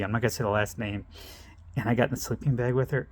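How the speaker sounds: plain reading voice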